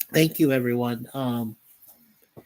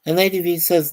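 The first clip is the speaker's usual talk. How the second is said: ordinary talk, nothing marked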